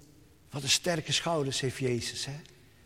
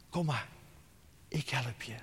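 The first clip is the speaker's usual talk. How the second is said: tender whisper; 3 semitones lower, 8 dB quieter than usual. Acted. Jesus answers the drowning soul.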